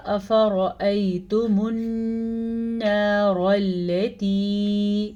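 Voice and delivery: slow melodic tajweed chant; measured Quranic recitation. Shown as a demonstration. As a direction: recite slowly, drawing out each vowel. Recitation demo.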